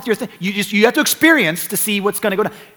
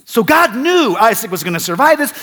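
usual speaking voice

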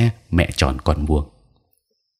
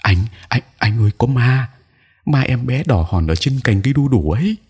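high-pitched, mildly panicked voice; a touch of breathless alarm; panting fright; 7 semitones higher than usual. Acted. Wife telling her husband she saw a ghost, high and panicky. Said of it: "giọng hồn hển".

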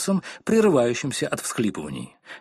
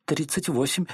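flustered stammer; 4 semitones higher than usual. A narrator's reading. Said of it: "смешалась запуганная миссис Корнер".

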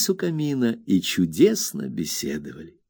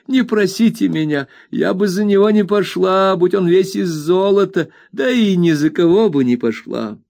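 an indignant servant, voice pitched up; flustered old nurse; 10 semitones higher, 4 dB louder than usual. A narrator's reading. Peggotty voiced high.

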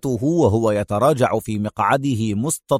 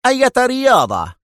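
high, impatient question; irritated, high-pitched challenge; sharp; 12 semitones higher, 4 dB louder than usual. A narrator's reading. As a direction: demand it sharply, pitch shot up. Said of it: "سأله المفتش في حدة".

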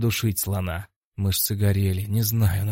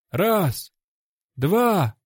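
high, called-out referee count; distant count; 8 semitones higher, 3 dB louder than usual. A narrator's reading. Referee counts over the fallen fighter, high distant call. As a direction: call the numbers out as if from afar. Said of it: "раздалось откуда-то издалека".

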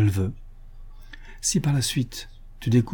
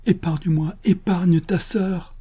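high, slow pleading cry; 8 semitones higher, slower than usual. Acted. Aline begging Julien to spare his sister, high and slow. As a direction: beg him, drawn out and high. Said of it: "tout en te criant".

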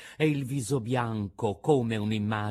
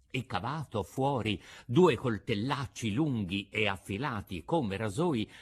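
usual speaking voice

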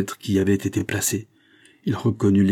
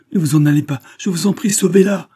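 high, loud, frantic begging; desperate pleading; 10 semitones higher, 7 dB louder than usual. A narrator's reading. Husband begging surgeon to save his wife, desperate. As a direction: implore him not to leave, voice raised high.

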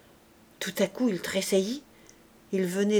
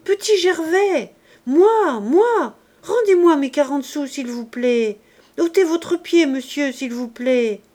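put-on pleading child; 5 semitones higher, 7 dB louder than usual. A narrator's reading. Child pleads for his coin.

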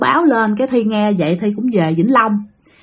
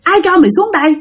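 high, fierce scolding cry; ghost mother's angry shout; 6 semitones higher than usual. Retold or imitated. Ghost mother yelling at the dreamer, high and angry. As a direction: snarl at the intruder like a furious spirit. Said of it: "bả đánh Thi tới tấp luôn".

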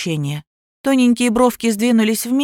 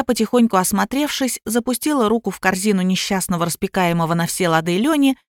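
ordinary delivery, nothing marked

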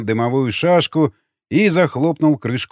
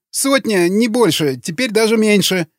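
high, whining prisoner voice; frightened confession; 8 semitones higher than usual. A narrator's reading. Prisoner at knifepoint explains, frightened. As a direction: plead and inform in a high, scared pitch.

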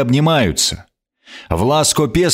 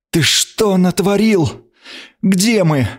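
the queen's voice pitched higher, a nasty hissed demand; angry hiss; 10 semitones higher, slower than usual. A narrator's reading. Frightened queen hisses at the man who brought her here. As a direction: hiss it at him in alarm, spiteful. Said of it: "как она злобно прошипела".

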